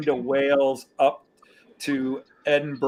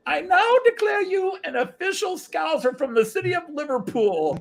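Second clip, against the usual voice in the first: high, ceremonial declaration; playful imitation of a solemn knighting, pitched high; mock knighting proclamation; 13 semitones higher than usual. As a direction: proclaim it grandly in a raised voice. Chemist's mock knighting ceremony acted out.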